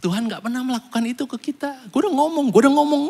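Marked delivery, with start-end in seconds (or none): 1.9-3.0 higher, needling taunt; sing-song like a gloating friend; mock told-you-so again. Preacher contrasts God with a smug friend: higher taunt.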